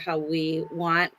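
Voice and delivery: usual speaking voice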